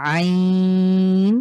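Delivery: আইন squeezed from mid-throat, long and drawn out; long Arabic ain from the throat; throaty ain. Shown as a demonstration. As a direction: press the throat and hold আইন. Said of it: "খ যেভাবে উচ্চারণ করেছি same".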